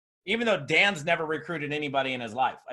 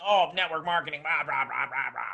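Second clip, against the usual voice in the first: mock negativity; 2 semitones lower than usual. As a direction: run down into scornful blahs. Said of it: "all negative about".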